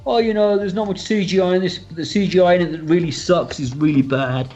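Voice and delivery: high, whiny mock critic voice. Director mocking critics who call the CGI bad, in a high sneering voice. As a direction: sneer it like a smug online critic. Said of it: "when people go".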